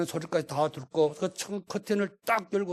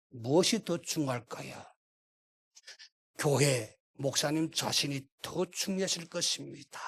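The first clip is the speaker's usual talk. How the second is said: ordinary talk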